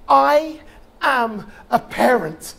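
loud, slow proclamation; a slow, loud rallying cry; battle cry. Acted. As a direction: draw it out and call it like a battle cry. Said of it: "here comes my battle cry".

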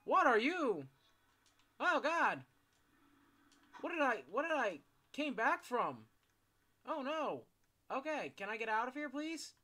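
loud, high desperate begging; panicked pleading. Real, not acted. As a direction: plead to get out, terrified. Trapped, begging to escape.

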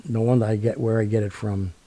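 ordinary delivery

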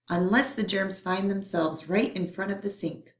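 ordinary delivery

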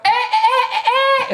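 squeaky high chirping birdsong; high bird song imitation. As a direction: chirp like a nightingale.

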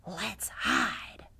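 secret unvoiced whisper; conspiratorial child's whisper. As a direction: whisper the plan to the gang. Cowboy Baby plots hiding.

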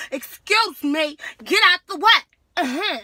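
high, very loud angry shout; loud confrontational yell. Retold or imitated. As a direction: blast it at him to move. Retold classroom confrontation, yelling.

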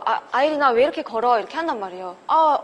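usual speaking voice

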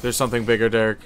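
loud urgent warning; alarmed shout. Real, not acted. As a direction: call out the danger. Warning teammate of monster.

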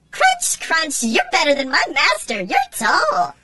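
very high squeaky little creature calling; shrill tiny tin-can critter; tiny robot voice. A narrator's reading. Tin can robot tugging Jesse's leg, high squeaky call.

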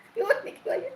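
high burst of laughter; can't stop laughing. Real, not acted. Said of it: "ചിരിക്കണ കാരണം".

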